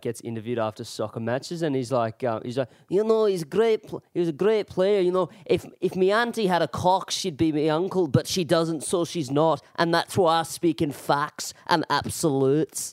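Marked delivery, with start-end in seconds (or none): 2.9-12.9 put-on higher Scouse accent, a post-match interview ramble; Scouse footballer accent. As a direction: lightly put on Scouse accent, matter-of-fact. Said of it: "that one Scouse dude who, like, gets interviewed".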